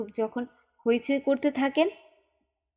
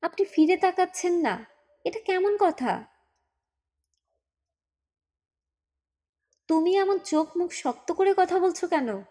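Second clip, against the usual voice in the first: uncle pleads higher in pitch, indignant; higher, upset brother-in-law; heated protest; 6 semitones higher than usual. Acted. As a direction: push back, outraged for the baby. Uncle and mother quarrel.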